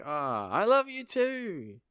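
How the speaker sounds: high, loud, drawn-out mock yell; shouting over noise. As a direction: holler the answer back slowly. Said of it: "she can't hear 'cause it's fucking noisy".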